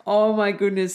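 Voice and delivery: ordinary talk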